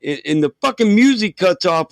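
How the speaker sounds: ordinary talk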